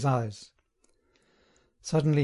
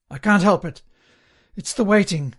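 higher, louder flustered plea; agitated, raised-pitch apology; urgent embarrassed excuse; 5 semitones higher, 4 dB louder than usual. A narrator's reading. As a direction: blurt out your excuse, unable to hold on. Prisoner desperate for the lavatory.

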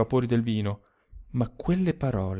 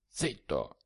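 much softer, a sharp hush; 6 semitones lower, 11 dB quieter than usual. A narrator's reading. Sturzi hushes the shouting narrator, much softer. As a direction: finger to lips, shush him. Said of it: "mettendosi l'indice attraverso le labbra".

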